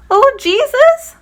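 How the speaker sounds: long, high, loud slow call. Acted. Blind beggar calling to Jesus over the noisy crowd, loud and high. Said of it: "he kept calling louder still".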